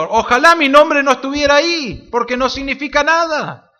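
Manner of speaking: a hint of Pablo disclaiming himself; voicing Pablo.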